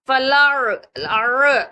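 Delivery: फलार slow with Ain in the throat; Arabic ain in फलार. Shown as a demonstration. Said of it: "इस तरह की आवाज आनी चाहिए".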